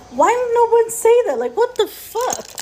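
mock outraged yelp that falls away; retold exasperated outburst. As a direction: throw the question up in disbelief. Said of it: "I'm like".

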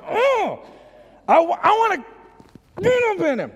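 high, straining burst of affection; overwhelmed doting Father. Acted. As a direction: squeal as if bursting with love. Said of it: "That's what the Father's like".

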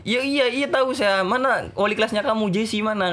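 high, flustered questioning; impatient teacher cutting in, retold; acted panic. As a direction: urgently demand who is in charge. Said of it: "Saya kan jadi panik juga".

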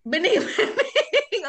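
stretched out slowly through real laughter; laughing repeat. Real, not acted. Host cracking up at the guest's answer, laughing. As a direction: laugh the word out high and slow.

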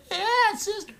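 very high mock fan squeal; squeaky put-on fan voice; excited wrestling fan. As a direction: imitate an overexcited fan way up high. Imitating excited fans at wrestling.

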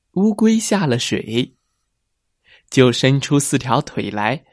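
usual speaking voice

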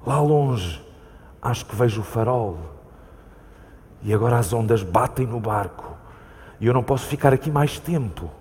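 ordinary delivery

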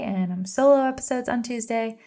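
normal talking voice

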